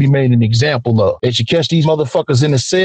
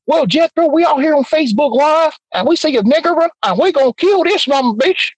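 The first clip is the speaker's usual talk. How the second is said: hillbilly voice; 11 semitones higher than usual. Retold or imitated. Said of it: "let me see how Hillbilly talk".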